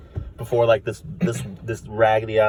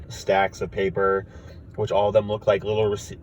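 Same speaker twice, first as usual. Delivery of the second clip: usual speaking voice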